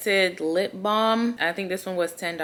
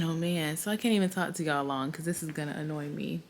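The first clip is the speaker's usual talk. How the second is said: ordinary talk, nothing marked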